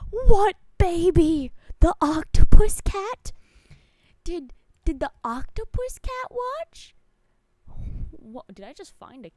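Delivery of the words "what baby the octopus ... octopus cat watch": loud incredulous yelling; outraged shout. Real, not acted. Streamer yelling at a friend's confession, outraged shout. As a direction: shout the questions in disbelief.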